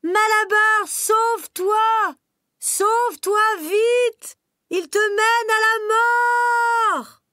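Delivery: very high, loud, slow anguished shout; voice of catastrophe. Acted. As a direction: scream his name in horror. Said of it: "d'une voix de catastrophe". (Douce crie à Malabar, d'une voix de catastrophe)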